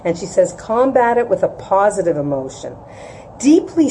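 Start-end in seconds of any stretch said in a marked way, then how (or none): none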